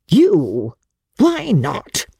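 quick, cutting comeback; sharp retort. A narrator's reading. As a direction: snap the question back. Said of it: "she jerked back at him".

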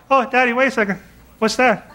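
a hint of a small daughter asking; lightly voiced little girl.

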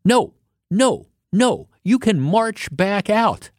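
lightly voiced stern shop worker; imitating a yelling clerk. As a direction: play the fed-up employee telling them off. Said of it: "one woman who starts yelling at 'em".

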